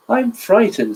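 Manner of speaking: small, high, timid voice. A narrator's reading. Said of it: "said Mister Dizzy in a small voice".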